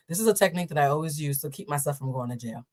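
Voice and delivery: ordinary delivery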